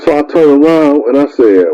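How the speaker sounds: normal talking voice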